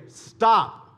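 loud, high, sudden command; imitated dad's shout. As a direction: call out as dad to halt the kids. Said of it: "we heard our dad say".

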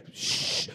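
long, soft "shh"; drawn-out shush; imitated shushing. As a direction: hush them like a disapproving crowd. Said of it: "when the world is saying".

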